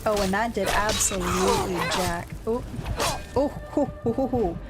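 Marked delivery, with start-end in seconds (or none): none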